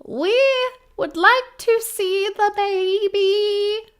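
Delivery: high, slow, earnest cult spokesman; earnest cultist. Acted. Cultist at the Winnebago door asks for the baby, high earnest voice. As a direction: announce yourselves like an awkward cult envoy.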